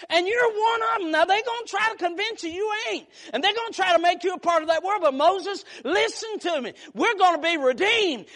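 ordinary delivery

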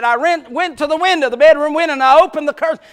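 building suspense from the pulpit; full-force preaching. Acted. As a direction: drive the story like a revival preacher.

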